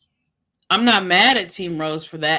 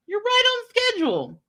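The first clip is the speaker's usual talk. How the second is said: sarcastic repeat; 13 semitones higher than usual. Acted.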